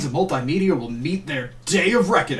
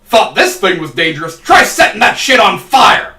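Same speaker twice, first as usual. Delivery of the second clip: excited shout; 5 semitones higher, 9 dB louder than usual. Acted. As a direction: build up to a big shout. Hyped about the fire whip.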